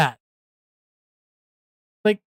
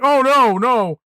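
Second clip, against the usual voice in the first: a mock flustered higher, louder "oh no"; mock backpedal; 5 semitones higher, 4 dB louder, slower than usual. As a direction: play someone caught out and backpedalling. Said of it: "then being like".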